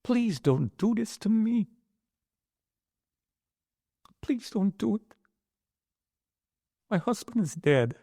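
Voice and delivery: high, slow, pleading retelling of the wife; tearful pleading. Retold or imitated. Wife on the phone to Stan, high slow grieving plea. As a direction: beg slowly, pitched high with grief.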